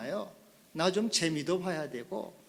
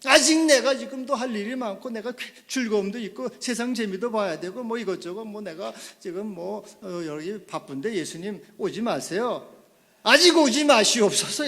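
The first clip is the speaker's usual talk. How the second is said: high, loud flustered pleading; panicked plea; startled panic; 4 semitones higher, 8 dB louder than usual. Retold or imitated. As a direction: beg him to wait, caught off guard. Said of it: "깜짝 놀라는".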